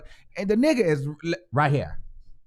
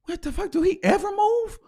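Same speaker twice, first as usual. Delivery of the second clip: incredulous squeak; 11 semitones higher than usual. Retold or imitated.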